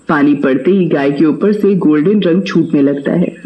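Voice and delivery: plain reading voice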